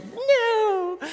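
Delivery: high, loud mock squeal; imitated mad child. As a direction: squeal it like a cross toddler. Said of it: "She gets mad at herself".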